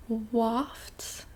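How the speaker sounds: ordinary delivery, nothing marked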